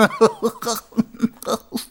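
high, smug, needling giggle; high mocking chuckle; condescending chuckle. A narrator's reading. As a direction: laugh like the joke is on him. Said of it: "das verdammte Lachen".

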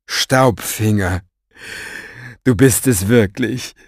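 slow, moved and glad; happy. A narrator's reading. Orpheus greets the returned Staubfinger, slow and glad. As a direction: savour the name, genuinely happy. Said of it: "Es klang tatsächlich Glück aus seiner Stimme".

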